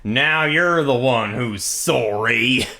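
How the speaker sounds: slow, drawn-out Texan payoff; Texan accent. Pretend. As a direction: land the threat in a Texan drawl. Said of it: "and said".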